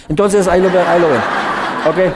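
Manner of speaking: laughing. Real, not acted. Laughing with the group.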